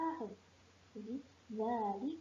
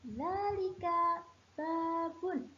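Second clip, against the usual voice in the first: Dzalika babun lifted high and drawn out slowly; teacher pitching Dzalika babun up high, slow; high, slow model answer; 6 semitones higher, 4 dB louder, slower than usual. Shown as a demonstration. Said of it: "Solih, bentar".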